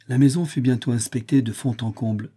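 ordinary delivery, nothing marked

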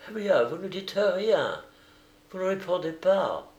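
high, needling character voice; prodding American. A narrator's reading. American prods the silent father.

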